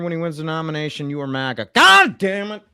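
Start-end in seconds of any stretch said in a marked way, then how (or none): 1.7-2.6 loud, high-pitched cursing burst; exasperated outburst. Acted. Streamer cursing mid-rant, exasperated shout. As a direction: explode in frustration.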